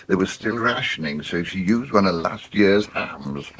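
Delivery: ordinary delivery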